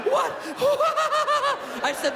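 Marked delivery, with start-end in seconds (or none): 0.1-1.8 high, loud stoned cackling; dad's giggle fit. Retold or imitated. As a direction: cackle like a giddy stoned dad. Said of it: "exactly how my dad walks in".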